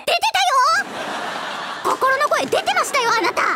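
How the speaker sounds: excited laughing. Real, not acted. Teasing partner about inner voice.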